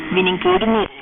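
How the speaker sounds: normal talking voice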